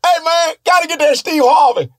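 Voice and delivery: high shouted heckle; hollering fan. Retold or imitated. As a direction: yell it from the back of the crowd. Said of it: "Sometimes they go".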